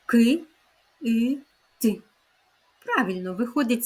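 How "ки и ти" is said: "Ки, и, ти" as three separate sounds; teacher's segmented "Ки, и, ти" for repeating; sounded out. Shown as a demonstration. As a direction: break the word into "Ки, и, ти", pausing between. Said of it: "з яких звуків складається слово кит".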